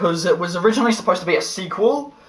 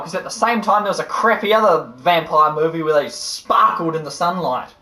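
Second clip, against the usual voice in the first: normal talking voice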